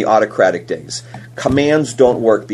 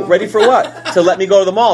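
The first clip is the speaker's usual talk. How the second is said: laughing retelling; 7 semitones higher, 3 dB louder than usual. Retold or imitated. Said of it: "And I go".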